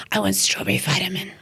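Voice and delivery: put-on whispery Batman-like toddler rasp; raspy little nephew. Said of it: "He's Batman".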